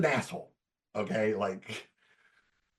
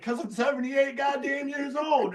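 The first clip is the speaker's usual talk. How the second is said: hammed-up old-timer retort; grumpy old player; 13 semitones higher than usual. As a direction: repeat the punchline in the cranky old man's voice. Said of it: "he's just like".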